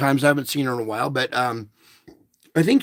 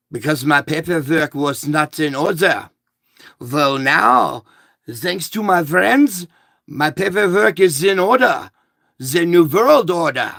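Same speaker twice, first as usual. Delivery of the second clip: hammed-up German accent, growing louder; mock German accent; 4 dB louder than usual. Customer announcing his papers are in order, mock German accent. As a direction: put on a theatrical German accent and proclaim it.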